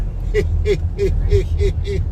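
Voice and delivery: soft, gentle giggle; quiet nice giggle. Acted. Unseen spirit child giggles softly at the frightened man. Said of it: "a quiet, nice giggle".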